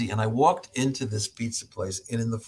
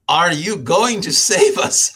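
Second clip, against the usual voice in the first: slow, loud, higher Eastern European voice; Eastern European accent; 6 semitones higher, 9 dB louder, slower than usual. Retold or imitated. An imposing colleague sizing up the new doctor, slow mock Eastern European accent. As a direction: loom over the newcomer, imitating a slow Eastern European accent. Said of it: "sounds Eastern European".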